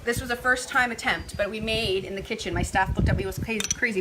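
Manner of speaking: ordinary talk, nothing marked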